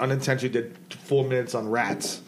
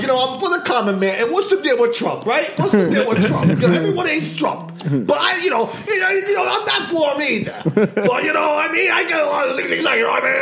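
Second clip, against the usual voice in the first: put-on obnoxious kid comic; 11 semitones higher than usual. Mocking the obnoxious kid who did open mic. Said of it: "the most obnoxious fucking kid".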